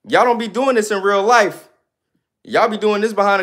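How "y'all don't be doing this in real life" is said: ordinary talk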